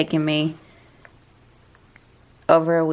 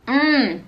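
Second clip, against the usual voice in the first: pleased hum; 7 semitones higher than usual. Real, not acted. Tasting the acai bowl again.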